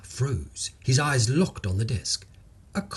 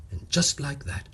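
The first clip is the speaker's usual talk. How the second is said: ordinary delivery, nothing marked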